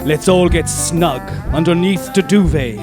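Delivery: usual speaking voice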